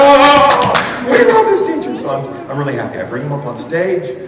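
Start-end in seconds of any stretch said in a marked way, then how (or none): 0.0-2.0 high, loud mock adoration; kids going crazy. Kids as their teacher walks the aisle, high excited mock cheer. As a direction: imitate screaming young fans, pitched way up.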